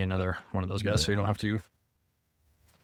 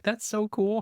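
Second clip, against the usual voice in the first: high, gushing mom voice; impressed mom; 13 semitones higher, slower than usual. Retold or imitated. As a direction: squeal it like an amazed mom. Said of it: "and she was like".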